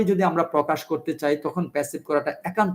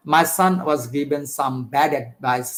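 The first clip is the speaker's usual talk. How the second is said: slow dictation; much slower than usual. Shown as a demonstration. Teacher dictates example sentence.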